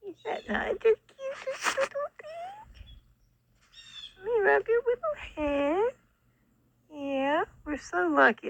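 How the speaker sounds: high, tender squeaky cooing. Real, not acted. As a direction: gush sweetly over the kitten. Cooing at the kitten.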